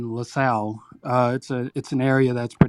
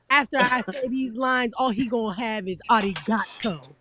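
arrogant battle rapper; 8 semitones higher, 3 dB quieter than usual. Retold or imitated. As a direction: gloat over the opponent. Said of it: "he be like".

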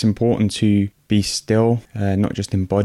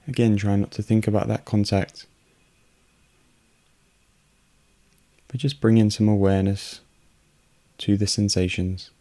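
unhurried meditation voice with long pauses; guided meditation. Real, not acted. Host guiding listeners through a breathing meditation, calm and unhurried. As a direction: guide calmly in short, evenly pitched phrases, leaving space for breath.

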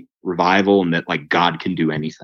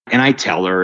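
usual speaking voice

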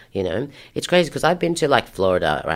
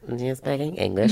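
lightly mocked higher-pitched questioning voice; silly Becky; 5 semitones higher than usual. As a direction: imitate a clueless party guest's surprised question. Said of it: "silly little Becky with the good hair".

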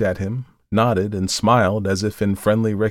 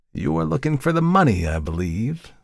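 lightly polite, lifted then dropping away; everyday politeness; 6 semitones higher than usual. A narrator's reading. Visitor addresses the terrified Markheim, with casual politeness. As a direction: make it a courteous, matter-of-course remark. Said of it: "in the tones of everyday politeness".

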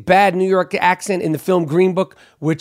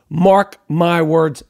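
slow emphatic; much slower than usual. Real, not acted. Confident prediction.

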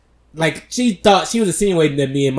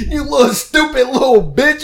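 higher, laughing furious insult; mock angry mom; 10 semitones higher, 4 dB louder than usual. Mom cussing out the clerk, mock furious. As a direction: hurl the insult, half laughing. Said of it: "She was like".

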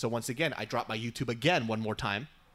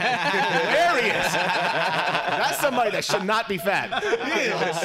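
slow, loud laughing delivery; can barely get it out for laughing; loud, slow words through real laughter; 6 semitones higher, 8 dB louder, much slower than usual. Real, not acted. Laughing about friend's photos.

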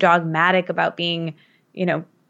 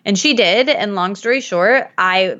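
ordinary talk, nothing marked

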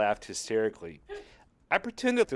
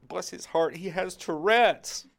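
prissy pity; 8 semitones higher, 3 dB louder, slower than usual. Retold or imitated.

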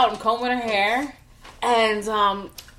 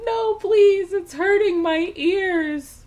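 put-on much higher child voice, slow and pleading; mock whiny child, drawn out; little daughter begging; 12 semitones higher, 4 dB louder, much slower than usual. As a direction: plead like a kid whose ears hurt. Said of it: "She's like".

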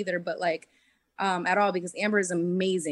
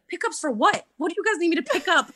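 high, louder retold disbelief, quick at the end; shocked; 7 semitones higher, 4 dB louder than usual. Player retelling being asked to do pickups, shocked. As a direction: reenact baffled surprise with a laugh creeping in. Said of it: "I was so shocked".

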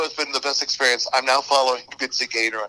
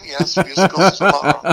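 Mary Poppins manner; 4 semitones higher, 6 dB louder than usual. Retold or imitated. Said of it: "a little bit of Mary Poppins".

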